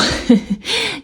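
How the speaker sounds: short high giggle; high laugh. Real, not acted. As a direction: laugh at your own slip. Laughs at own slip.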